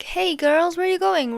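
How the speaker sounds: lightly voiced neighbour, pitched high; high, singsong imitation of a passer-by; retold neighbour calling.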